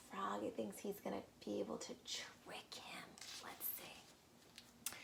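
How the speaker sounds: hushed secretive whisper. Real, not acted. Teacher whispering Frog's plan to the children, hushed. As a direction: whisper like sharing a trick. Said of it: "conspiratorial aside".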